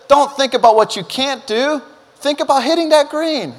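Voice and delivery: coaching urge. Retold or imitated. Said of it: "I said".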